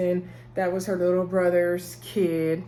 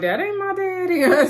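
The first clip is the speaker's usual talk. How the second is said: put-on kid voice; 11 semitones higher, 5 dB louder than usual. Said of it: "The kids was like".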